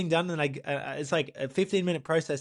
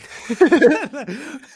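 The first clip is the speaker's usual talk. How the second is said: high burst of laughter; 12 semitones higher, 10 dB louder than usual. Real, not acted. Laughs retelling ambulance.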